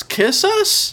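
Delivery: squeaky, incredulous question on the last request; high questioning squeak. Pretend. Podcast host ending a list of pleas, high questioning squeak. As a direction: leap way up high, rising. Mock surprise in sign-off list.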